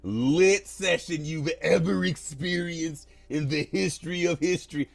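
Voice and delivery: slow, hammed-up gushing; mock over-the-top rave; oversold praise. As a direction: oversell it like a rehearsed review. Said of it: "you gotta say it like that".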